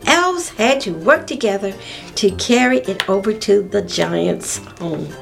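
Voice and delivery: plain reading voice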